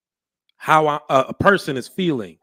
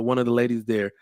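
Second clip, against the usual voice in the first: usual speaking voice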